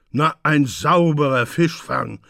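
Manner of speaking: loud, disgusted scoff. A narrator's reading. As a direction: spit it out in disgust. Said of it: "zeigte einen großen Abscheu".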